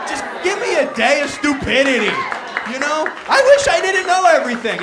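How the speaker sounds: loud, high exasperated rant; comic rant. Real, not acted. Comic to audience, loud exasperated rant. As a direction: beg for ignorance at full volume. Ranting wish to be dumb.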